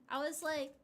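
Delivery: ordinary talk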